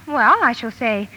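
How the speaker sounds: ordinary talk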